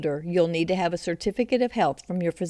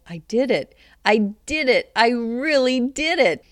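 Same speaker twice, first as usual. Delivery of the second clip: proud exclamation, building louder and higher; grinning triumph; 4 semitones higher, 5 dB louder than usual. A narrator's reading. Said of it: "Grinning from ear to ear".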